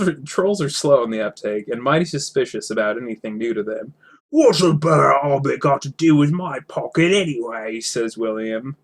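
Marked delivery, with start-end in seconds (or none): 4.3-7.8 troll. Acted.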